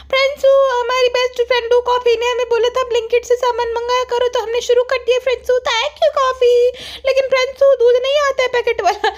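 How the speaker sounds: high, sing-song vlogger whine; mock a childish vlogger addressing her viewers; vlogger daughter. (Vlogger की नकल, बहुत ऊँची आवाज़)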